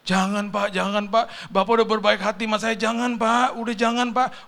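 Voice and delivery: high, loud pleading protest; imitated tearful refusal. Courier refusing money from the car owner, tearful pleading. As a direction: beg him off in a high mock-crying voice.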